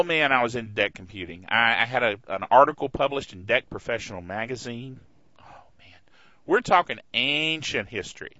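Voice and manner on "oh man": whisper. Real, not acted. Whispered aside.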